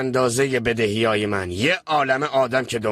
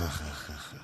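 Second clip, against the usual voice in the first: pained groans; 8 semitones lower, 16 dB quieter than usual. Acted. Character groaning in distress.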